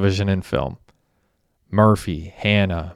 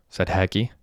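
plain reading voice

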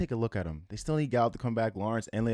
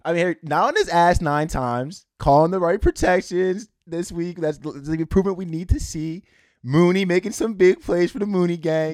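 loud, high-pitched fan rant; excited hype; 7 semitones higher, 9 dB louder than usual. Real, not acted. Fan cheering the line's better protection, excited hype. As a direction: push each point out loud and high, fired up. Fan celebrating his team's improvement.

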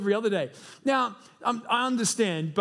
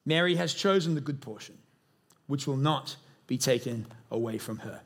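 measured, low scripture reading; 7 semitones lower than usual. Acted. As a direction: read the verse aloud with solemn pauses. Reads scripture aloud to congregation.

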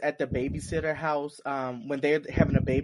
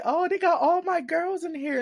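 excited squeal. Retold or imitated. Excited fan reaction, quoted.

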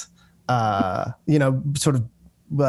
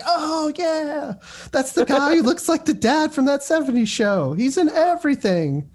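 high excited recognition; 12 semitones higher than usual. Retold or imitated. Imitates own TV-watching reaction.

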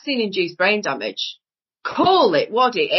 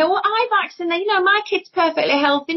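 lightly mimicked parent, pitched higher; mock a parent's protest in a higher voice; mimicked defensive parent; 8 semitones higher than usual.

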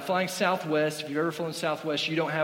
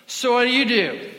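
tipsy lady voice; 8 semitones higher, 9 dB louder than usual. Retold or imitated. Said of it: "the next question".

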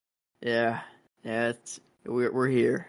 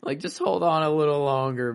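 pleading; 6 semitones higher than usual. Retold or imitated. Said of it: "And I'm like".

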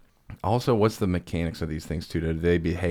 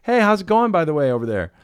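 much higher and louder, calling across the table; shouting over music; 11 semitones higher, 5 dB louder than usual. Retold or imitated. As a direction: shout over the bar noise to a friend. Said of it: "and you're like".

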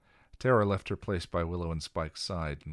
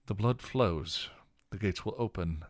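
recited; 4 semitones higher, 4 dB louder than usual. Acted. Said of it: "Giles recited from the scroll".

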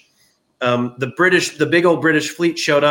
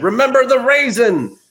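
a high, punchy battle slogan; mock rallying cry, raised and loud; 9 semitones higher, 4 dB louder than usual. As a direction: call it out like a recruiting war cry. Rallying slogan for Kentucky recruits.

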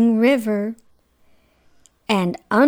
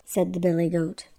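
usual speaking voice

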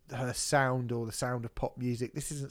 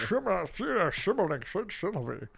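slushy Scottish Connery lisp on shimmer, a touch higher; mock the slip in Connery's Scottish voice; Sean Connery impression; 4 semitones higher, 5 dB louder than usual. Co-host teasing the tongue slip, Scottish Connery impression. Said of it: "Sound like Sean Connery".

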